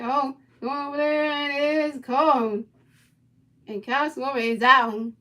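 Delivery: loud, rising, slowing call of the out; announcer shouting the out on a fly ball; excited play call. Pretend. Calling a big play.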